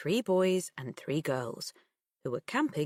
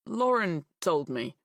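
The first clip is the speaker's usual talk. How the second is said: plain reading voice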